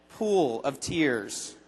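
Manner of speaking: low, very slow mock weeping; hammed-up gloom, low and slow; mock sorrow. Shown as a demonstration. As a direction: drag it out like sinking into tears. Mock sad outside system.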